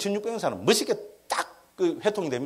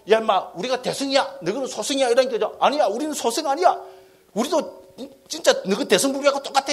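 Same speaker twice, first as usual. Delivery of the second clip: higher-pitched acted bickering; mock sectarian squabble; 7 semitones higher than usual. Lecturer voicing rival Buddhist schools arguing, mock indignant protest. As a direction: imitate rivals protesting their standing.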